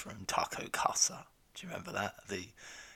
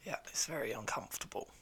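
hoarse whisper with a cold; croaky sick voice; 3 semitones higher, 6 dB quieter, faster than usual. Real, not acted. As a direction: rasp it out, throat sore. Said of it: "Sound like you have a frog in your throat".

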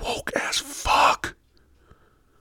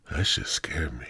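ordinary talk

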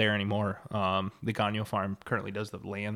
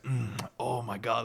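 slow, imitated moan of enjoyment; savoring food; slower than usual. Farmer imitating his friend eating pork, savoring it slowly. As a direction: act like a passionate eater relishing a bite. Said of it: "make all these noises, like, you're really enjoying the process".

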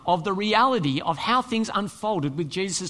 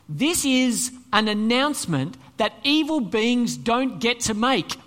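usual speaking voice

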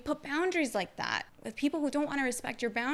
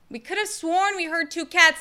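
mock neighbor; 7 semitones higher, 7 dB louder than usual. Neighbor voice in retelling.

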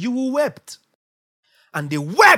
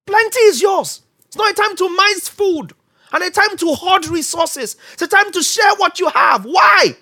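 higher, louder preaching cry; fervent preaching; 8 semitones higher, 4 dB louder than usual. Real, not acted. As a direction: preach it to the congregation. Preaching at full force.

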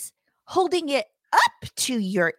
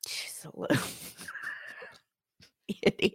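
soft unvoiced aside; whispering; 4 semitones lower, 9 dB quieter than usual. Real, not acted. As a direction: whisper it under your breath. Aside under breath.

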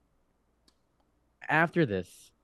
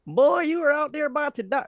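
high, loud Southern holler; hammed-up old Southern man, pitched way up; very Southern accent; 5 dB louder than usual. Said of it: "Speaking a very Southern accent".

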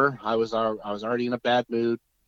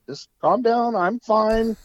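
calming dad; 12 semitones higher, 6 dB louder, slower than usual. Retold or imitated. Reassuring panicked dad.

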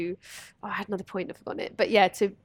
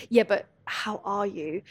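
mock intrusive concern; slower than usual. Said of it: "they'd be like".

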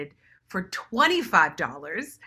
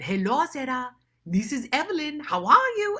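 West African auntie greeting, higher and climbing; auntie's West African accent; 7 semitones higher, 4 dB louder than usual. Retold or imitated. Aunt calling Sarah on the phone, in a West African accent. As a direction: greet her warmly in a West African lilt. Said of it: "I couldn't get the dialect".

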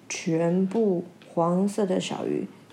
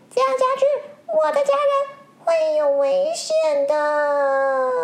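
much higher and slow, fretting; worried fish; 5 dB louder than usual. Acted. Yellow fish fearing for family, high slow worry. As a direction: dread for the family in every word.

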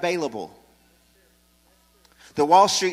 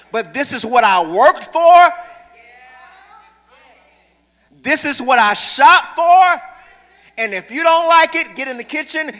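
high, loud scolding mother; much higher and louder, a mother fed up with complaints; mama scolding; 8 semitones higher, 9 dB louder than usual. Retold or imitated. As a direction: lay down the law like mama at the stove.

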